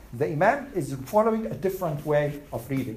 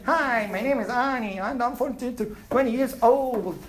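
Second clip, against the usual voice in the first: Southern accent; 5 semitones higher than usual. Shown as a demonstration. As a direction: put on an exaggerated Southern American accent. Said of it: "yes, Southern accent".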